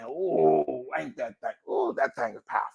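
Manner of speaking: soft, real awed reaction; hushed awe. Real, not acted. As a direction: marvel quietly to yourself. Awed reaction to own saying.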